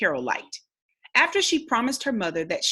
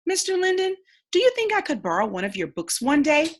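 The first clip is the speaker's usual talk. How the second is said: put-on young girl asking; 8 semitones higher than usual. Girl asking to borrow books.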